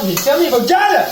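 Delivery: play-yell at the viewers; hammed-up angry shout; mock angry shout. Snapping at imagined viewers.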